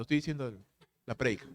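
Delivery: ordinary delivery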